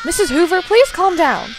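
calm the patient and call for help; urgent nurse. Acted. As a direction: nurse raising voice in an emergency. Nurse calms patient, calls staff.

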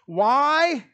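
usual speaking voice

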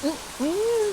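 amazed exclamation. Real, not acted. Reacting to heavy rain.

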